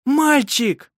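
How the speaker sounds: high, loud joyful cry; triumphant shout. A narrator's reading. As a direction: announce the birth in triumph. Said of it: "торжествующе крикнула она".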